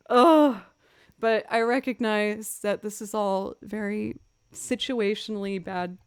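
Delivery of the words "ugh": a loud fed-up groan; loud frustrated ugh. Real, not acted. Streamer venting to chat, loud groan. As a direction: let out a big exasperated groan. Groaning after ranting about morning.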